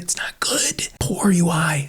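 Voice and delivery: soft unvoiced whisper. Real, not acted. Developer whispering a dig at Gemini in BigQuery, hushed. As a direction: whisper it like a secret jab. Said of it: "why am I whispering?".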